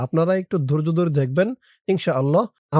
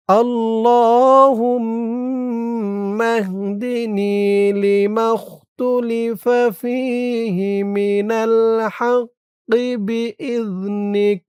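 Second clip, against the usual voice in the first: drawn-out, chanted Arabic recitation, higher and slow; slow dua recitation; 5 semitones higher, much slower than usual. Shown as a demonstration. Teacher reciting the dua for students, slow chant. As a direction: intone each word of the dua slowly.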